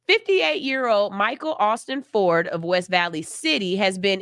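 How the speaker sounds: steady, level news-report reading. Acted. Vlogger reading a news report to viewers, formal news read. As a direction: read the article straight like an anchor. Reads a news story aloud.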